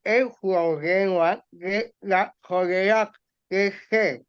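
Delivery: slow, muffled words round a pencil held in the teeth; pencil in the mouth. Shown as a demonstration. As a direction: read carefully with a pencil gripped in your mouth. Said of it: "Voy a hacerlo una vez más". (lectura lenta y apagada con el lápiz en la boca)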